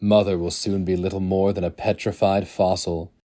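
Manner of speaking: usual speaking voice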